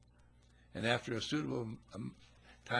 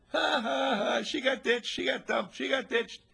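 put-on jeering kids; 14 semitones higher, 7 dB louder than usual. Rowdy kids mocking the bride.

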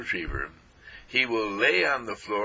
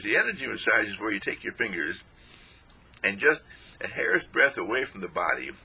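ordinary talk, nothing marked

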